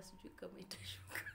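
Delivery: whispering past a lump in the throat; real, soft voiceless murmur, fighting tears; choked up. Real, not acted. Trying to push rising tears down, choked-up whisper. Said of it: "Na feel kitu kinapanda hapa".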